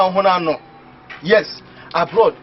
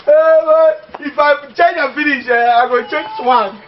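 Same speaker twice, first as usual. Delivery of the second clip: gleeful boast; 8 semitones higher, 4 dB louder, slower than usual. Real, not acted. Boasting gleefully about plans.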